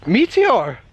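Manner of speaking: high, puzzled echo of the word; a blank older lady repeating it back. Retold or imitated. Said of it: "looks at me blankly".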